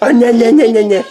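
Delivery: high, loud nasal-syllable chant; mock a fussy baby to get giggles; playful high "nah-nah" imitation; mimicking baby fussing. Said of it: "your brother and the nah, nah, nah".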